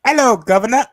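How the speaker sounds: loud, high-pitched English accent greeting. Shown as a demonstration. Showing friends their best English accent, loud hammed-up English accent. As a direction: belt out a cheeky English hello. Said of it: "hear my best English accent".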